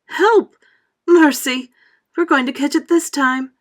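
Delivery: high, loud frightened cries; panicked cries. A narrator's reading. Travelers under the storm cloud, loud panicked cries. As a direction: scream for help in panic. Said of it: "they said".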